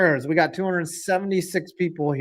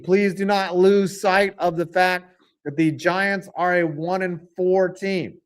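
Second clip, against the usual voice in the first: normal talking voice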